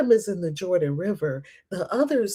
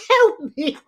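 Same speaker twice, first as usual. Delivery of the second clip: high, loud cry for help; mock plea; 9 semitones higher, 5 dB louder than usual. Pleading for help with the confusing story, mock loud plea.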